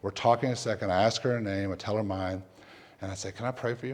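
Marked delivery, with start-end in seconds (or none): 3.3-3.9 very soft retold question; gentle. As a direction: offer it tenderly, barely above a murmur. Retold gentle offer of prayer.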